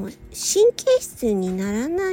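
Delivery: usual speaking voice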